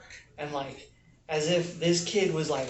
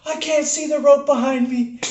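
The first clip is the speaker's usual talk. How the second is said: much higher and louder desperate cry; panicked kid; 11 semitones higher, 9 dB louder than usual. Retold or imitated. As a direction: plead it like a stuck scared kid. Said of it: "And he's like".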